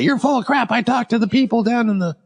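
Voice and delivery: high, mock-scoffing woman's voice; mocking coworker. Imitating the coworker brushing off his story, high and mocking.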